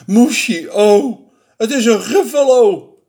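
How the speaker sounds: high, loud exclamation; hammed-up storybook alarm; alarmed cry. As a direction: cry out in horror at the monster. Mouse recognises the monster.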